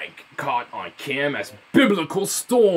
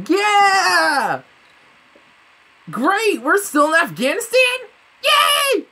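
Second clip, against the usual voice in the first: high, loud sarcastic celebration; sarcastic cheer; 7 dB louder, much slower than usual. Acted. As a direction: shout fake joy, dripping sarcasm. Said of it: "Of course, I'm being sarcastic".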